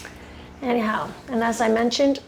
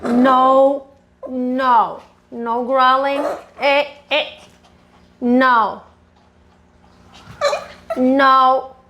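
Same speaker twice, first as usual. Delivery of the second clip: loud, sharp no to a growling dog; firm scolding; 5 dB louder, much slower than usual. Real, not acted.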